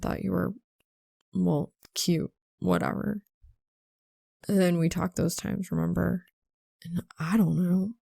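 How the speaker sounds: a hint of a shy guy admitting feelings; Sol, awkward confession. A narrator's reading.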